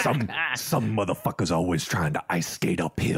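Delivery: low imitated bat voice; Blade. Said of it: "his bat voice".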